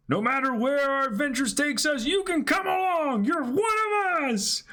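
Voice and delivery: high crying shout. Acted. Reading manga aloud to a friend, high crying shout. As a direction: wail the lines like a weeping kid.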